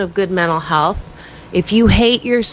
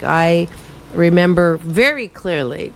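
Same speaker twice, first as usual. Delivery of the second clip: usual speaking voice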